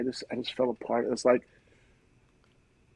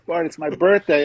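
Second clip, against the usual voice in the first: raised, louder retelling of the news; retold announcement; 6 semitones higher, 8 dB louder than usual. Brother tells him about his birthday, retold loud and raised.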